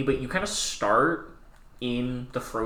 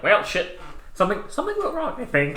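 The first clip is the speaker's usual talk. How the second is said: bewildered "well, shit"; 10 semitones higher, faster than usual. Retold or imitated. Player reacting to dragon attack.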